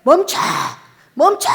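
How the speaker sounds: God shouting at the pastor to stop. Retold or imitated. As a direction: yell 멈춰 to halt the pastor. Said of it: "막 소리를 막 질러대는 거죠".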